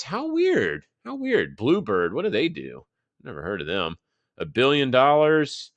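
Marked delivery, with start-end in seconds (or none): 0.0-1.6 high, mock-amazed sing-song; sarcastic mock wonder, pitched way up. Real, not acted. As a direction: pretend to be baffled by the obvious. Sarcastic about yet another high.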